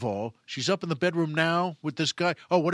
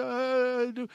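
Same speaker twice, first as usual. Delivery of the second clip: high, drawn-out mock whimper; acted sobbing maid; crying; 7 semitones higher than usual. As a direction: wail it tearfully. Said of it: "she's whimpering, she's crying".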